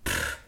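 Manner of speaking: scornful "pff" of air; dismissive puff. A narrator's reading. Mother dismisses child's question. (abfälliges Schnauben)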